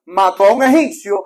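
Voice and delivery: loud, driving sermon delivery; preaching at full force. Acted. Preacher telling the congregation about Moses, loud full-force preaching. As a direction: thunder it from the pulpit.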